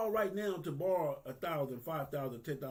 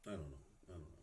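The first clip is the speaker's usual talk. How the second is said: getting emotional; 16 dB quieter, much faster than usual. Real, not acted. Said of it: "I'm getting emotional".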